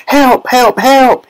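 put-on very high-pitched cries of a drowning boy; high, frantic calls; panicked yell. A narrator's reading. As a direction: yell desperately to be rescued. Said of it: "he yelled".

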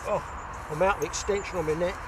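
normal talking voice

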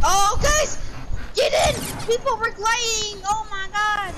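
loud urgent yell; urgent shout. Real, not acted. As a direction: call the team in fast. Urgent callout.

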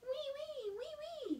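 high thin whining wee; high mosquito buzz-whine. A narrator's reading. Mosquito sound in story.

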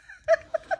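very high, delighted squealing laugh; squeal of laughter. Real, not acted. Vlogger squealing with laughter at the giraffe sticker pun.

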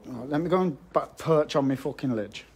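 ordinary talk, nothing marked

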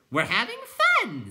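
high, loud, drawn-out creature voice; gleeful clown. A narrator's reading. Clown answering companions, high gleeful call. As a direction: sing out the fun, stretched.

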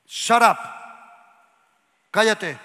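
sharp, loud command; firm command. Shown as a demonstration. Showing authority over a demon, loud firm command. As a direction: snap the order out. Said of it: "Cuando uno tiene autoridad, dice".

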